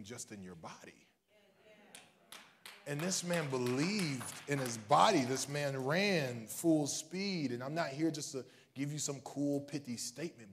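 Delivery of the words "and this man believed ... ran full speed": loud, slow, weighty declaration; slow emphasis. Real, not acted. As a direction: hammer each word slowly.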